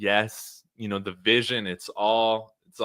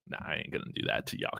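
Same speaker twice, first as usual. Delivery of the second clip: fast, unvoiced whisper, much lower and softer; whispered aside; 8 semitones lower, 12 dB quieter, much faster than usual. Real, not acted. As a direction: whisper it quickly as a private aside. Aside retracting joke.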